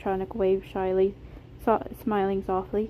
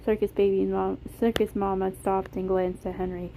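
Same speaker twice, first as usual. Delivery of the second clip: usual speaking voice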